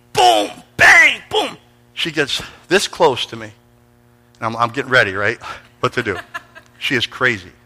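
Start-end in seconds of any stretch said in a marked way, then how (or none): 0.1-1.6 high, loud shouted bangs; imitated smashing, high and loud; crash sounds.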